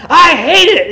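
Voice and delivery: mock furious outburst, very high and loud; upset old lady. Old lady insisting she hates the Lord's Prayer song, hammed-up upset. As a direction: imitate her boiling over, pitch leaping way up. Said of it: "she was just getting so upset".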